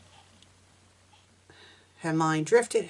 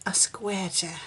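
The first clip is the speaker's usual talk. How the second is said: whispering; 8 dB quieter than usual. Real, not acted. Whispered while reading.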